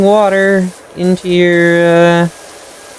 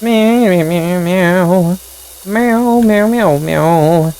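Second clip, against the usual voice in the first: higher, plaintive mock cat meowing; cat meows; 3 semitones higher, slower than usual. Cat stuck on roof calling for help, higher mock meows. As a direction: cry like a kitten stuck up high.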